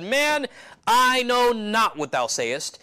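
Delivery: high, drawn-out protest from scripture; emphatic denial. Acted. Peter denying to a man that he follows Jesus, emphatic. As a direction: deny it slowly, pitched well up.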